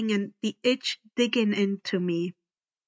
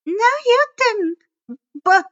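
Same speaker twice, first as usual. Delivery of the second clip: stern teacher; 11 semitones higher, 8 dB louder than usual. A narrator's reading. Miss Dutz cuts Grover off.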